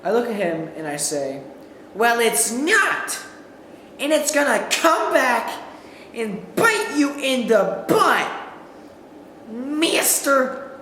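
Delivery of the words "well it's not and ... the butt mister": slow, lightly scolding mom voice; mom's comeback. Retold or imitated. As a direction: wag the finger like a mom, slowly. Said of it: "my mom saying".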